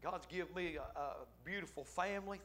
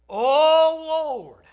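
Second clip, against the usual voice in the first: much higher, much louder cry to God; fervent cry; 11 semitones higher, 21 dB louder than usual. Shown as a demonstration. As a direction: holler it out like at the altar.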